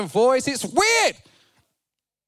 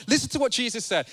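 ordinary delivery

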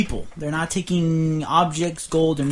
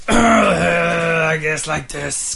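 gravelly orc king; 2 semitones higher, 5 dB louder, much slower than usual. Shown as a demonstration. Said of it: "kind of gravelly, a little lower".

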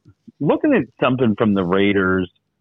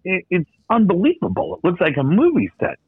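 amazed; 8 semitones higher than usual. Real, not acted. Amazed at owner's mansion.

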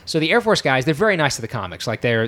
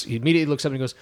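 normal talking voice

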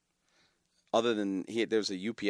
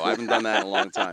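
chuckling through the line, louder; laughing; 7 dB louder, faster than usual. Real, not acted. To the host after his joke, laughing. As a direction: laugh the words out.

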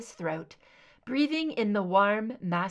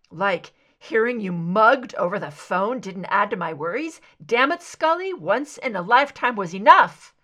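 angry outburst; 9 dB louder than usual. Acted. Mulder yells at Scully after her mugging, loud and angry. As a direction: yell it at her, frightened and furious.

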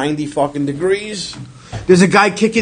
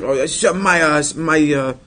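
mock broken English; slower than usual. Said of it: "The Chinese guy's like".

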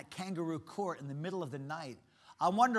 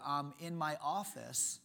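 ordinary delivery

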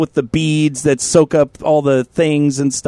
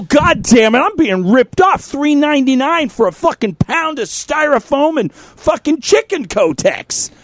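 retold steroid rage; 9 semitones higher than usual. Host reliving his steroid rage at the chicken package, higher ranting. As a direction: rant like you're being robbed. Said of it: "in my fucking steroid rage, I go".

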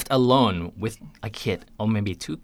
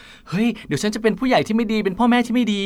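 anxious worry; 10 semitones higher, much faster than usual. Retold or imitated. Anxious parent's inner voice quoted.

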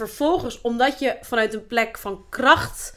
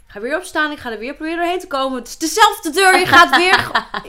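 exasperated husband; 5 semitones higher, 5 dB louder than usual. Retold or imitated. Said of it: "mijn man wel eens een keer tegen me zei".

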